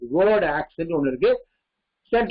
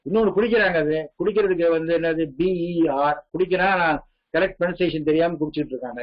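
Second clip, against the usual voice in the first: ordinary delivery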